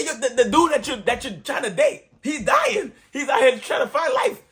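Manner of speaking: high-pitched, indignant retelling; exasperated protest. Retold or imitated. Injured man scolding the girl he's dating, exasperated protest.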